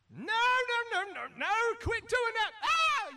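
high, loud, pleading no-no-no; frantic Paul. Acted. Paul begging the church to stop, frantic and high. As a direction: beg them to stop, tearing your hair out. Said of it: "Paul's pulling the hair he didn't have out".

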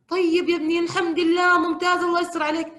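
high, loud delighted reply; overjoyed mother. Retold or imitated. Mother thrilled by her son's call, high and loud. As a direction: gush with relief to the son.

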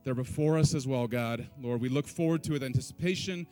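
ordinary talk

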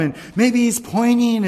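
ordinary talk

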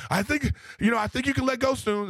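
put-on nervous kid. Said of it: "And I was like".

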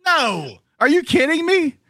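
high-pitched are you kidding me; incredulous. Real, not acted. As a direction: voice jumps high in disbelief. Disbelieving reaction to argument.

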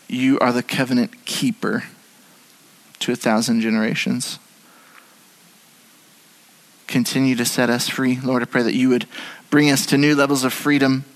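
heartfelt spoken prayer to Jesus; closing prayer. Real, not acted. Pastor prays over the congregation, sincere prayer. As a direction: pray it out sincerely, unhurried.

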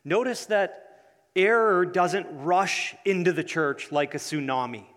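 ordinary talk

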